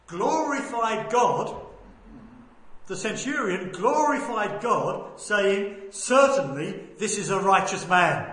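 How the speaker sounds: slow, drawn-out sermon delivery; emphatic preaching. Real, not acted. Preacher quoting the centurion's verdict, preaching emphatically. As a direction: stretch the key words with weight.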